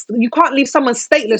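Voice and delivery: normal talking voice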